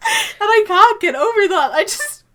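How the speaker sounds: words squeezed out through laughter; laughing while talking. Real, not acted. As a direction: talk while still cracking up.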